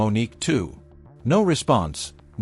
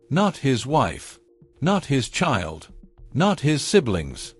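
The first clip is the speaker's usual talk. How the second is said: slow, each "Not" hit hard, falling; stress on NOT; slower than usual. A narrator's reading. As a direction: punch every "Not", lingering on each. List building to the reveal.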